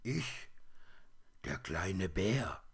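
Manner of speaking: ordinary delivery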